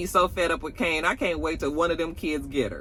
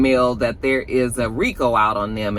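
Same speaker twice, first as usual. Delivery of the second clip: ordinary talk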